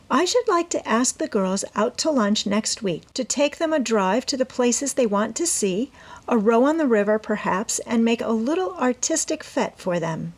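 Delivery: put-on eager young woman explaining her plan to her mother; Amy's voice. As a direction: plead your case brightly as the eager daughter.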